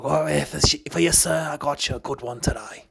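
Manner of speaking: light Australian barkeep voice, friendly; a hint of Australian, serving a regular; Australian accent. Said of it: "Sometimes Australian coffee comes out to play".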